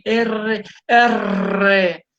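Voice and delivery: R with a strongly trilled, drawn-out "rr"; erre bien pronunciada. Shown as a demonstration. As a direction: roll the tongue long on R, showing it off. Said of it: "ahora sí pronuncio bien". (erre con vibración fuerte y alargada en R)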